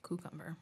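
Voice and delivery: "Cucumber" starting "koo", said softly; soft "Cucumber" with "koo" for "kyoo"; regional pronunciation. Retold or imitated. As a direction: say "Cucumber" as "koo-kum-ber". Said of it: "I've heard that".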